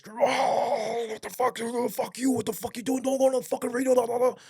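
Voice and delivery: high mock yelling of a boss losing it; imitating raging boss. Boss raging at the sergeant over the radio call, high hammed-up yelling. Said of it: "swinging it and just going off hinge".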